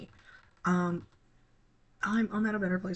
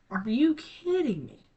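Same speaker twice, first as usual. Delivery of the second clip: higher, louder, slowed-down are you kidding me; exasperated disbelief; 7 semitones higher, 6 dB louder than usual. Real, not acted. Streamer losing her thread, exasperated outburst. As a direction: snap out in sudden exasperation.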